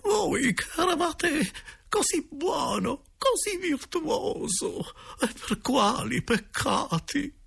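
very high, slow falsetto lament; hammed-up tearful, high-pitched plea; pleading directress. A narrator's reading. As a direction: wail it in a drawn-out falsetto. Directress pleads with uncle's ghost.

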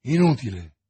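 low, firm, hopeless verdict; grim resignation. A narrator's reading. Athos telling his friend help is pointless, grim resignation. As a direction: cut the hope off gravely.

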